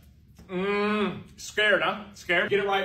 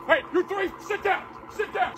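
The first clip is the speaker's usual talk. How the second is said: high, forceful police orders; worked-up, raised-pitch ordering; aggressive cop shout; 13 semitones higher than usual. Acted. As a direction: shout commands like an overexcited cop. Cop shouts at trespassing kids.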